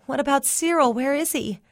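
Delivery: high, worried questions; anxious. A narrator's reading. Asking the neighbour about Cyril, anxious. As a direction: ask urgently, pitched well up.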